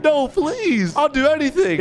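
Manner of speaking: high, hammed-up pleading; mock begging. Bully begging the narrator not to tell his mom, high mock pleading. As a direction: beg like a scared kid, half-joking.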